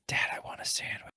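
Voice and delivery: daughter's whispered ask at the deli. Retold or imitated. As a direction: breathe it like a secret little girl. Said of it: "she's just like".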